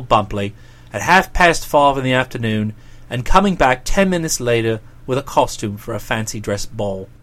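deep, deliberate dialogue voice; Boko, sceptical then impressed. Acted. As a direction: play a low-voiced chap weighing things up. Boko's lines in narrated dialogue.

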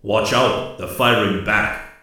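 shouted warning through a helmet; urgent shout ringing inside a helmet; knight helmet. A narrator's reading. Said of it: "Koa's voice rang in his helmet".

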